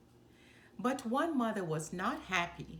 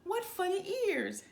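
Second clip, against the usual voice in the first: higher mocking elephant ladies; mildly raised teasing voices; 10 semitones higher than usual. A narrator's reading. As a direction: laugh at the ears, mocking. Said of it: "they said".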